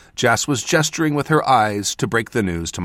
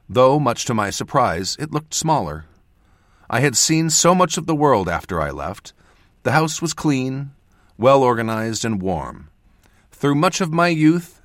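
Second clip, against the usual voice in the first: plain reading voice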